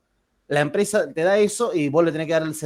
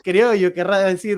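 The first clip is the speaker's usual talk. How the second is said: "criollo" sung out higher and louder in a Córdoba lilt, drifting Riojan; a hammed-up Córdoba tonada on "criollo"; 5 semitones higher, 4 dB louder than usual. Baker correcting her bizcochito order, in a mock Córdoba tonada. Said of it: "me sale como medio como que me voy pa' La Rioja".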